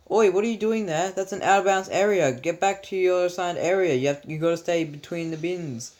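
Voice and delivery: scolding teacher. Retold or imitated. Teacher sending kids back from the food area, bossy scolding. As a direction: order them back like a strict teacher. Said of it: "one of the teachers was like".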